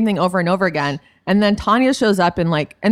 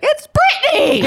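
excited shout; 4 dB louder, slower than usual. Retold or imitated. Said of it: "I saw you and I was like".

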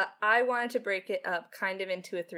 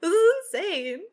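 high, loud gushing squeal, much higher than her own voice; excited squeal; 12 semitones higher, 10 dB louder than usual. Retold or imitated. Writer gushing to her family about fan art, in a high, loud squeal. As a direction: squeal it out like you can't believe your luck. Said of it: "being like".

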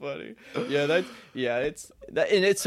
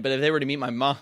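ordinary talk, nothing marked

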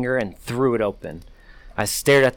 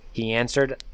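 ordinary delivery, nothing marked

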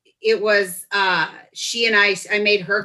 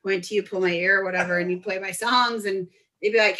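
ordinary talk, nothing marked

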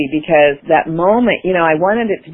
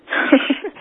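breathy laugh; 9 semitones higher, 3 dB quieter than usual. Real, not acted. Laughs at own boldness.

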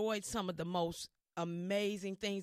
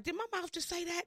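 high, disbelieving question; stunned at herself; 10 semitones higher than usual. Retold or imitated. Replaying her answer to Prince, higher and surprised. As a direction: catch yourself in surprise.

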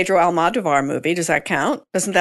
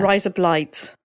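imitated Australian accent; slower than usual. Host copying the co-host's phrase, Australian accent. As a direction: copy of a friend's Aussie vowels. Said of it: "Australian accent".

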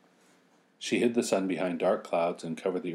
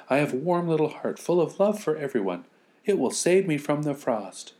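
put-on high girlish voice; earnest little girl; 8 semitones higher than usual. Girl answering the neighbors, high and earnest. Girl describes her warm heart.

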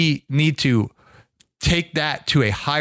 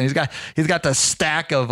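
ordinary talk

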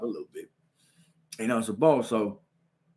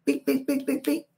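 higher beep tapping noises; head taps; 12 semitones higher than usual. Retold or imitated. Girl hitting him from behind, tapping noises. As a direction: mimic the taps on his head.

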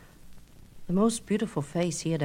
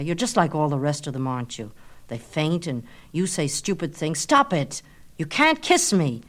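scornful scolding; 2 semitones lower than usual. Acted. Narcissus scolding Echo.

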